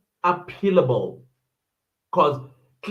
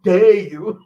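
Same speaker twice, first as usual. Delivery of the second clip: louder indignant question through a laugh; half-laughing outrage; real amused fury; livid, laughing; 3 semitones higher, 5 dB louder than usual. Real, not acted. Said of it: "that made me livid".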